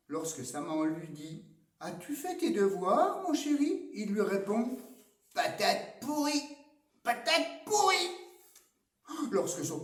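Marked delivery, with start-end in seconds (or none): 1.8-3.9 high, sugary mom asking about homework; mother coaxing her son, sweetly. Acted. Mother voiced in story. 5.3-6.6 put-on much higher kid voice spitting "pourrie"; boy sulking at his mother, high sulky voice; sulky kid insult. Simon's rude reply. 7.0-8.1 even higher, louder, hammering "pourrie"; boy repeating the insult, shriller and louder; louder sulky insult. Acted.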